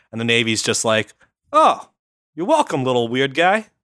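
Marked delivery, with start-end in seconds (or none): 1.5-3.7 a squeaky high "Oh" then amused talking-down; mock-polite naval officer; condescending Navy brass. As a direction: pat the little weird guy on the head. Navy mocks envoy.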